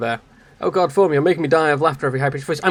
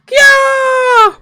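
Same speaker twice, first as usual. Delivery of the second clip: very high, loud cartoon shriek; a shrill anime girl squeal; high squeaky scream; 11 dB louder than usual. Startled anime girl, very high squeaky scream.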